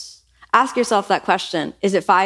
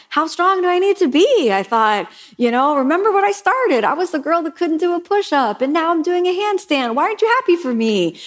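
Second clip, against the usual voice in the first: whiny self-pity; 9 semitones higher than usual. Retold or imitated. Student protesting to her teacher, whiny self-pity. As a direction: beg for recognition, aggrieved. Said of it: "that feeling of kind of self-pity".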